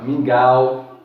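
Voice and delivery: mingau said loud and open, lips rounded on the au; mingau, loud open diphthong; demo word, said out loud. Shown as a demonstration. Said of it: "veja que você usa bastante a parte dos lábios".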